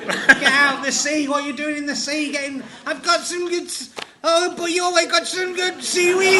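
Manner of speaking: high-pitched, hammed-up Welsh voice; Welsh accent impression. Comedian mocking Welsh seaweed eaters, hammed-up Welsh accent. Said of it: "That is the best impression you've ever done".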